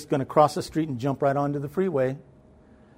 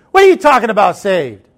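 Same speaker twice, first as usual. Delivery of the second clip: loud, high indignant outburst; imitated mad grandma; 4 semitones higher, 10 dB louder than usual. Grandma angered at being asked if saved, indignant imitation. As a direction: snap it back, offended, dropping on the last word. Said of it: "she got mad then".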